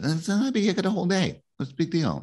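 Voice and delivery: high, breezy dismissive reply; imitating a carefree dad brushing it off. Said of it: "and I said".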